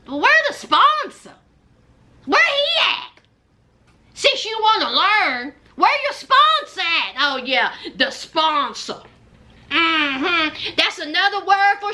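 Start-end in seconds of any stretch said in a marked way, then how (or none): 0.1-7.1 high, sassy what-where voice; sassy mock question. Mocking Courtney's missing sponsor, high and sassy. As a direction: needle her, pitch way up. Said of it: "and I thought".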